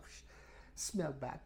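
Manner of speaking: ordinary delivery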